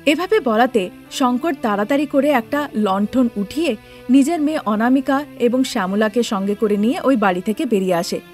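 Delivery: ordinary delivery, nothing marked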